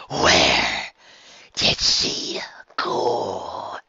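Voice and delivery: low angry demand; low furious demand. A narrator's reading. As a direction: growl out the question like a predator. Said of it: "Bon Bon demanded".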